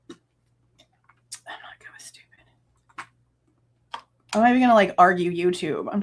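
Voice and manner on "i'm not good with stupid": breathy whispered repeat; a hushed, disgusted aside. Real, not acted. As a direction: whisper it to yourself, fed up.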